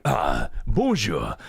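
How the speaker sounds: mock-French phone call; improvised French with a put-on French accent; Ocelot speaking French. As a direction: play Ocelot schmoozing in French. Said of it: "call someone else and be like".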